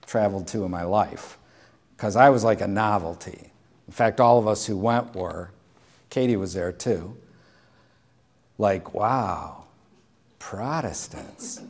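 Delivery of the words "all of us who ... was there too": ordinary delivery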